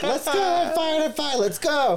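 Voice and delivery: high, hurried and laughing; squeaky laughing I'm fine; flustered brush-off. Retold or imitated. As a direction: wave everyone off, embarrassed. Embarrassed after slipping in line.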